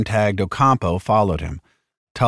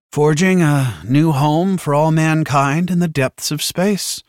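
smug tone; 7 semitones higher than usual. A narrator's reading. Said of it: "in the tone that usually made bullies homicidal".

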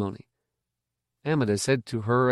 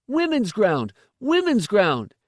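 high-pitched, mildly raised shout; shouted warning; 10 semitones higher, slower than usual. A narrator's reading. Officious boy blocks the stranger's way, shouting a warning. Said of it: "he shouted".

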